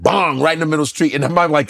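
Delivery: ordinary delivery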